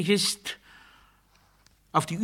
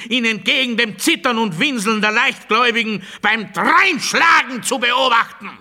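loud, higher, hammered declaration; mounting shout; 6 semitones higher, 5 dB louder than usual. Acted. Speaker railing that he never caught the Creator in the act, shouting. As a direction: build each repetition into a shout.

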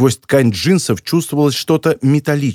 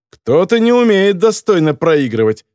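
ordinary delivery, nothing marked

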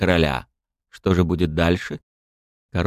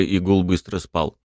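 usual speaking voice

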